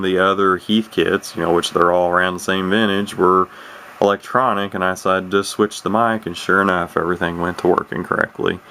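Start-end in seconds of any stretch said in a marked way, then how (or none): none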